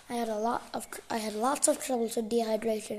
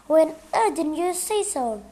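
loud, higher-pitched reproach; exasperated scolding; 5 semitones higher, 8 dB louder than usual. A narrator's reading. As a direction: scold out of embarrassment. Flustered aunt scolds the guest.

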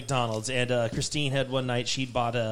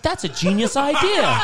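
laughing ditzy voice; 10 semitones higher, 4 dB louder than usual. Retold or imitated. Voicing Christine, laughing.

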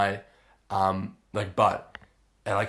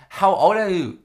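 imitated masseuse, much higher and louder; exasperated masseuse; 9 semitones higher, 8 dB louder than usual. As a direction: repeat the question loudly and insistently as the masseuse. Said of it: "And she goes".

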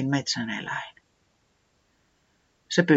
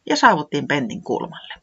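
plain reading voice

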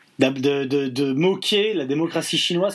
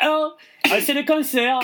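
southern French accent, grave bad news, higher; 10 semitones higher than usual. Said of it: "annonce une terrible nouvelle avec un accent du Sud".

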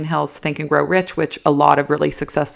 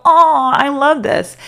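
high, loud, drawn-out imitation of delight; exaggerated swooning approval, stretched out; mock gushing; 10 semitones higher, 6 dB louder, slower than usual. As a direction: gush like an easily excited viewer. Acts out gushing video viewer.

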